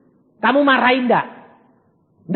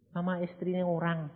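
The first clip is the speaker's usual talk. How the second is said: hushed reveal; 2 semitones higher, 13 dB quieter than usual. Retold or imitated.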